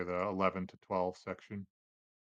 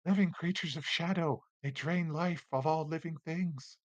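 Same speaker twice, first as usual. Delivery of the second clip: high-pitched little spirit voice; Wispy; 7 semitones higher than usual. Acted. Wisp explains the shadows to a player, in a high creature voice. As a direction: explain in a raised, otherworldly creature voice.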